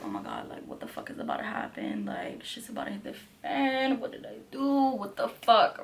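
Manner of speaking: hushed panicked whisper to herself; inner panic whisper. Retold or imitated. Sitting frozen in the chair, panicked whisper to herself. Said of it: "I'm, like, sitting in the chair like".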